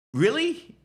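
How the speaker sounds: sharp, high disbelieving Really?; incredulous. Real, not acted. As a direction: scoff at the excuse in disbelief. Incredulous reaction to excuse.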